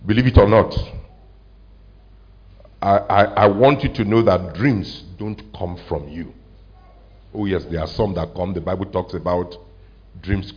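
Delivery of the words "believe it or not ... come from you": ordinary talk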